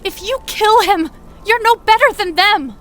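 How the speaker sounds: high, loud, desperate plea; panicked plea. Acted. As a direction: beg in panic. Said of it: "Amira was panic-stricken".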